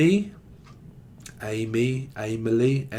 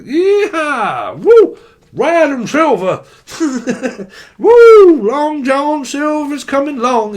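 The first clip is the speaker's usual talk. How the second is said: much higher, much louder yeehaw whoops; whooping cowboy yell; 10 semitones higher, 8 dB louder, much slower than usual. Acted.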